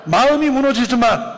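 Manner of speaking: high, loud preaching call; emphatic proclamation. Real, not acted. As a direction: ring it out to the congregation. Title proclaimed to congregation. (높고 크게 힘주어 선포)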